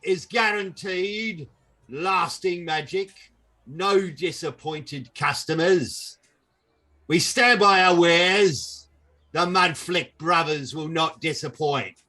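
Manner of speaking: pushy market seller pitch; hawking merchant. Acted. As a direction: sell it like a carnival huckster.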